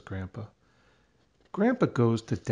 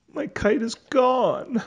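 put-on sad child voice; 12 semitones higher than usual. A narrator's reading. Child's kite flies away.

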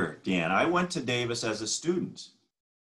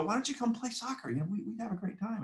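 high, light imitation of eager invitation; coaxing teammates; 12 semitones higher, 5 dB quieter than usual. Varsity guys invite him to play soccer, retold coaxing. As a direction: coax in a high, softened voice.

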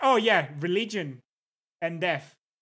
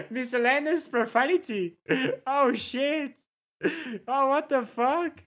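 high, laughing incredulity; laughing disbelief; 6 semitones higher than usual. Real, not acted. Streamer reading a list of Dutch profanity, laughing in disbelief. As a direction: break into laughter between the phrases.

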